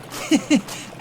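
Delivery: quick airy laugh, high and unvoiced; breathy amused laugh. Mocked by a thug, laughing it off breathily. Mocked about swallowing teeth.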